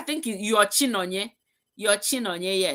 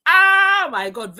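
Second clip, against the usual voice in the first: loud, high and slow swoon over food; craving delight; 8 semitones higher, 10 dB louder, slower than usual. Real, not acted. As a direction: savour it like the taste is in your mouth. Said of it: "I am kind of salivating".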